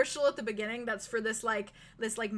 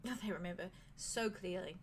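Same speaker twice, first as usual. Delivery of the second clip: soft, quiet British innkeeper voice; British accent; 5 semitones lower, 5 dB quieter than usual. Acted. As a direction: speak gently in a British lilt. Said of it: "slip into a British accent".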